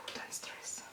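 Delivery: barely audible stressed whisper. Real, not acted. Vlogger stressed over fitting her lashes, whispering. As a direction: whisper it under your breath.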